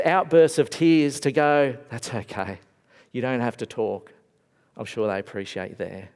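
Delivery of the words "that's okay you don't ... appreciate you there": low, soft reassuring voice; gentle comfort. Retold or imitated. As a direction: soothe someone in grief. Comforting a grieving friend.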